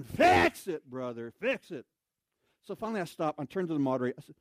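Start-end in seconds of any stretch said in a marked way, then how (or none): 0.0-1.9 mock yell. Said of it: "just yelling".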